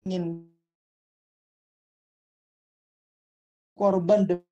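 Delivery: choppy, cutting-out audio; words dropping out over bad connection; breaking up connection. Real, not acted. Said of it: "putus-putus lagi".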